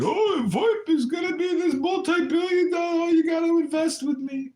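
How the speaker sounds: high, loud salesman hype; mock hype man. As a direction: pitch it like a pushy investor.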